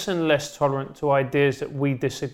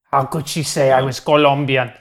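lightly put-on Venezuelan accent, mock indignant; a hint of a Venezuelan accent, mock outraged; 4 semitones lower, 4 dB louder than usual. Mocking his mother's outrage with a light Venezuelan accent. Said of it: "you can stop doing the accent".

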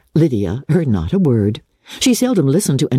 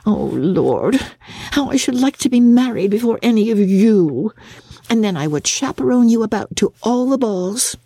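put-on high, giggly, breathless girl gushing; giddy young Lydia; 7 semitones higher than usual. A narrator's reading. Lydia gushing to her sisters in a high giddy voice. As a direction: gush like a silly teenage girl full of gossip.

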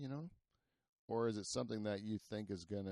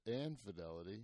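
ordinary delivery

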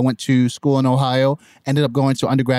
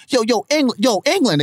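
much higher mock kid voice; high-pitched imitation of schoolkids; American kids hailing; 10 semitones higher than usual. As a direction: call out to the new kid, imitating. Acts out American kids hailing him.

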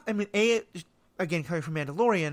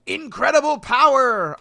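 booming villain cry, higher and louder; sneering panto villain; 8 semitones higher, 11 dB louder, slower than usual. Acted. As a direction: roar it like a gloating emperor. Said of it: "almost Emperor Palpatine".

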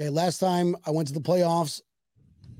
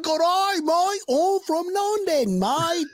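mock British accent; 11 semitones higher, slower than usual. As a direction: shout a cartoon British accent across the pub. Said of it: "I get to talk and walk around going".

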